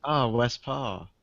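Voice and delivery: put-on slow Korean accent on Les Paul. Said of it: "I was doing a Korean voice".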